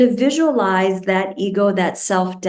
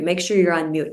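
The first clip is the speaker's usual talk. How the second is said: ordinary delivery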